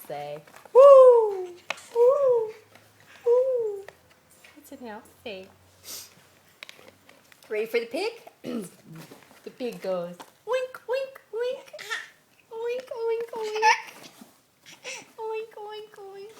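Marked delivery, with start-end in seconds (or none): 0.7-3.8 high, loud hooting calls; owl hoot. Pretend. Grandma hooting like an owl for the baby. 10.5-16.3 pig oinks. Pretend. Grandma oinking high and fading for the baby.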